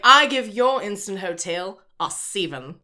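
imitated Australian TV contestant; "seven" said as "seeven", Australian style; Australian accent. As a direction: bend the vowels into mock Australian. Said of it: "the way that they say the number".

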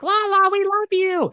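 very high, loud chorus of tipsy fans; drunk girls shouting. Retold or imitated. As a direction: yell it like a drunk group on FaceTime. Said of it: "they're like".

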